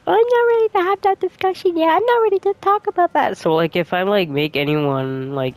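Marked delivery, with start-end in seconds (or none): 0.1-3.4 high, squeaky, fast whiny mockery; mocking other people. Streamer mocking people not ready for that discussion, squeaky mock voice. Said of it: "people are like".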